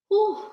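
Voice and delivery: high exhaled whew to regain composure; overcome, high-pitched puff of relief; steadying whew. Real, not acted. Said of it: "keep it together".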